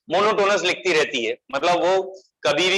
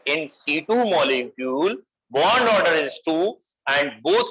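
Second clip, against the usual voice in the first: measured, drawn-out note dictation; slow dictation; much slower than usual. Real, not acted. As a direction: dictate slowly so students can write it down.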